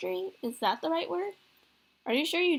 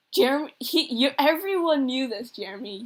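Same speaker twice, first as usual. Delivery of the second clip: loud, raised-pitch disbelief; acted incredulity; 3 semitones higher, 5 dB louder than usual. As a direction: sputter it out in astonished exasperation. Dad astonished at son's ignorance.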